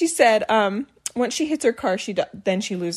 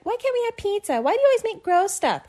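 high-pitched mock kid whine; whiny complaining kids; 10 semitones higher than usual. Mom imitating her kids complaining about gross food, high whine. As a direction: imitate children griping about dinner. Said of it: "They all start complaining".

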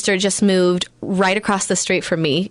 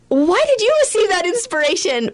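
jealous inner voice; 11 semitones higher than usual. Retold or imitated. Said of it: "at first I was like".

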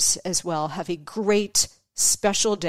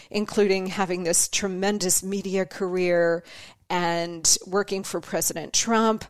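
normal talking voice

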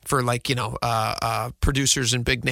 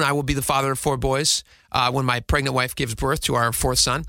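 ordinary delivery

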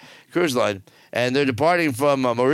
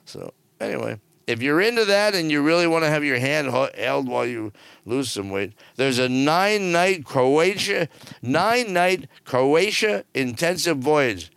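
usual speaking voice

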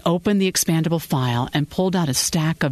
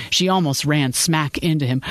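ordinary delivery, nothing marked

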